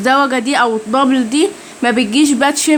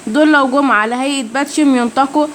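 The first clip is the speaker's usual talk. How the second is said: ordinary delivery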